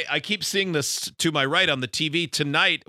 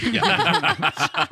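laughing. Real, not acted. Laughing at radio beep question.

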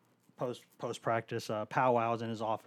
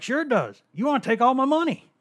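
much higher, louder mocking coach voice; imitating the coach; 13 semitones higher, 8 dB louder than usual. Coach Dooley accusing the liberal reporter, high mocking squeal. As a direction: squeal it like an outraged rich man. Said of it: "He's like".

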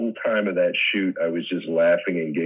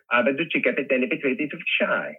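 high, silly nonsense question; Curly impression; 7 semitones higher than usual. Imitating Curly in the Stooges bit, light and high. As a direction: toss it off like a dazed Curly. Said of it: "Curly would go".

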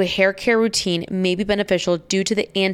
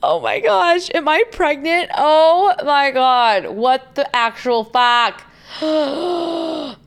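retching between the words; dry heaving; 7 semitones higher, much slower than usual. Real, not acted. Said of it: "full-blown gagging over here".

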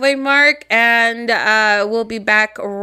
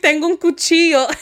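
half-strength mock scream, raised pitch; retold scream; 5 semitones higher than usual. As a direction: act out waking up screaming, not full force. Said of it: "I screamed".

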